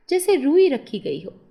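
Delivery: plain reading voice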